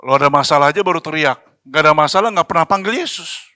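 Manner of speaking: lightly voiced heavenly reproach; mock reply from heaven. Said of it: "Nanti di surga jawab".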